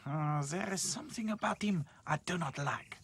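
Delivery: lightly French suspicious voice; French accent. Acted. Claude suspicious of the mouse, French accent. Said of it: "thought Claude".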